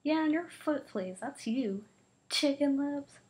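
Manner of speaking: high loud taunt; shouting playground insult. Acted. Kids trading insults.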